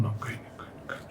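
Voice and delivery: breathless whisper through sobs; whispered denial. Retold or imitated. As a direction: hide it, barely a whisper. Crying child denies to grandma. (ધ્રુસકા વચ્ચે ધીમો ગણગણાટ)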